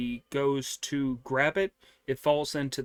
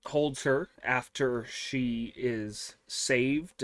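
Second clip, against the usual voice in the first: normal talking voice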